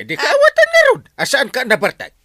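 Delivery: loud, high exasperated scolding; angry outburst. Acted. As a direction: blow up at him in disbelief. (nagpungtot a napigsa nga ikkis)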